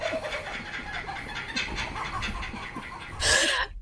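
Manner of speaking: long breathless high laughing fit; hysterical laughter. Real, not acted. Host laughing with guest at the celebration. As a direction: laugh helplessly and infectiously. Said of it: "celebratory laughter".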